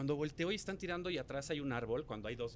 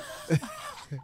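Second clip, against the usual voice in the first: loud, high laugh; 7 dB louder than usual. Real, not acted. Storyteller cracking up after his own scream, laughing. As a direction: burst out laughing.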